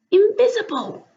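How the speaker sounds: ordinary delivery, nothing marked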